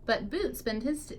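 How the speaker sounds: ordinary delivery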